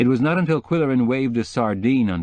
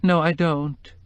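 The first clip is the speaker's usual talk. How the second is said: tearful woman; 7 semitones higher, slower than usual. Acted. Mrs Starkweather voice.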